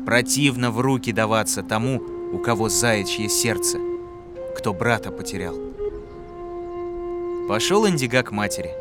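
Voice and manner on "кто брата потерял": ordinary delivery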